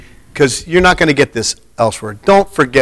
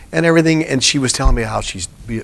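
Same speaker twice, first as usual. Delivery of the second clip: ordinary talk, nothing marked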